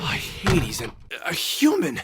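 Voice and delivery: startled. Acted. Finds a girl in shower.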